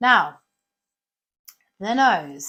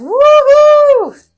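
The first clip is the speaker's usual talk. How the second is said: big high loud woohoo; excited whoop; 17 dB louder than usual. Acted. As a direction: whoop with pure delight. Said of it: "And he's going".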